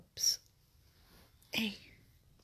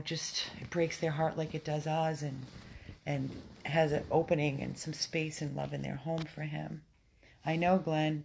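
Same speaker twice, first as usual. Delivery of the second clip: ordinary delivery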